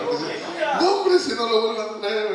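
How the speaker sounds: high, loud mock exclamation; joking protest about never being invited back, high and loud; mock outburst.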